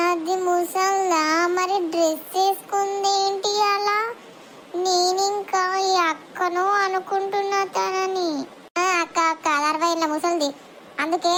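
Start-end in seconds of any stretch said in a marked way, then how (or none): 0.1-8.3 slow, drawn-out puzzled question; slow talker. Acted. Slow girl asks her friend about the old woman, very slowly. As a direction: let each word crawl out.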